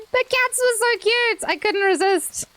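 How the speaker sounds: cats were so cute, said high and gushing. Real, not acted. Streamer explaining her scream to chat, high gushing. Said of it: "I had to scream".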